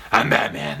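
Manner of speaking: low, gruff Batman growl; low-voiced Batman line. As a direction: deepen into the caped vigilante. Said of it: "one for sure that I use a lot".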